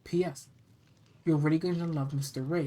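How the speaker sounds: plain reading voice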